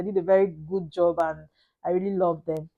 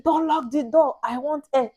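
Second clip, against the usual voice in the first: higher-pitched imitation of urgent begging; retold frantic plea; 7 semitones higher than usual. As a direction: plead urgently, re-enacting labor panic. Retells frantic plea during labor.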